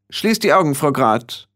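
hoch einsetzende, bestimmte Anweisung; scharfer Befehl. A narrator's reading. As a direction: keinen Widerspruch zulassen. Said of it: "eine gewisse Schärfe oder ein sonderbarer Ton". (mit gewisser Schärfe)

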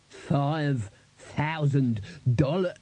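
very slow, rising echo; stunned disbelief. A narrator's reading. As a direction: stretch every word in disbelief.

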